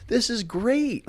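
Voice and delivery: bright fake cheer of a nervous vampire; mock Dracula voice. Dracula watching the werewolf transform, high mock villain voice. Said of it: "And he's like".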